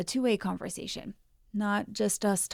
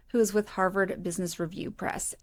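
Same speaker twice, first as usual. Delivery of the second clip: ordinary delivery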